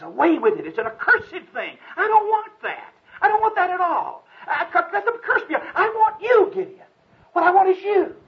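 high, loud pleading angel voice. Acted. The angel pleading with Gideon, high and loud. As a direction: beg him to stay with you.

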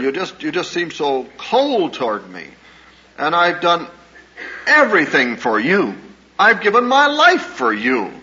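a mother begging her daughter to understand; hurt pleading mother. Retold or imitated. Mother pleading with her cold daughter, hurt and rising.

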